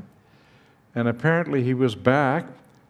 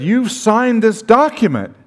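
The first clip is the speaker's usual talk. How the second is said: higher, loud accusing voice; angry accusation; 8 semitones higher, 6 dB louder than usual. Retold or imitated. Dean confronting the professor over the document, raised and accusing. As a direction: throw the accusation at him.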